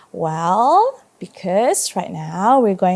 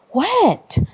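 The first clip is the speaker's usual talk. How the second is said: whisper; 10 semitones higher than usual. Acted. May's whispered question.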